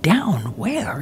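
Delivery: puzzled, barely above a whisper; hushed puzzlement. Retold or imitated. Searcher to hidden man below, hushed puzzled question.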